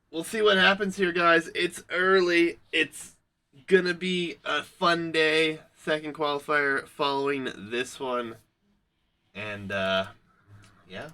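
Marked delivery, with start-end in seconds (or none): 0.1-8.3 loud, high-pitched caster hype; hype announcer. Real, not acted. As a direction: pump up the stream viewers, loud and lifted. Caster hypes up the tournament.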